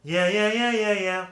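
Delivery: high, loud nasal resonance; nasal demo. Shown as a demonstration. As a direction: push the sound into the nose. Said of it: "So let me".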